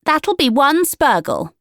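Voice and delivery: a big sister demanding the fee; bossy older sister. A narrator's reading. Juniper charging the customer, loud and bossy.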